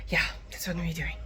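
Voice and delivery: quick whispered aside; fast whisper. Real, not acted. Telling viewers her plan, whispering fast. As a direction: rush it out in a whisper.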